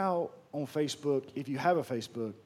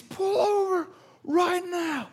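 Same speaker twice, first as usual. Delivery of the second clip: high, slow yell imitating an irate driver; mock yelling; slower than usual. Preacher imitating the road-rager beside him, high mock yelling. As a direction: stretch out each command in a strained high yell. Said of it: "I start yelling again back at this guy".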